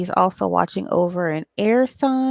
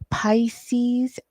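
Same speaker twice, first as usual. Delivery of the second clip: whisper; 3 semitones higher than usual. Real, not acted. Whispered sign name.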